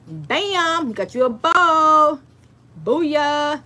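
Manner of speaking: high, showy, drawn-out ta-da; playful, loud celebration; corny triumph. Shown as a demonstration. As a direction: ham up the finished reveal. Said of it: "I'm kinda corny sometimes".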